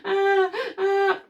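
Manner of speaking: high little mock baby whimpers; high-pitched settling fussing noises; calming baby cry. Shown as a demonstration. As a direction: imitate a baby's cry easing off. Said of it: "when the voice changes from being angry to".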